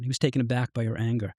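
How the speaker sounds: plain reading voice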